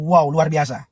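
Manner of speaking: ordinary delivery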